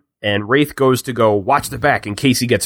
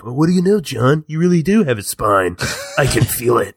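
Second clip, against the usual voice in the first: awful one-liner. Retold or imitated. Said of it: "an awful one-liner of".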